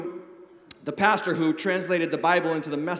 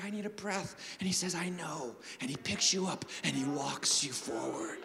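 tender hush; 9 dB quieter than usual. Real, not acted. Preacher softly picturing a father carrying his child. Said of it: "tender picture of father".

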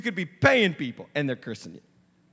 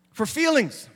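ordinary talk

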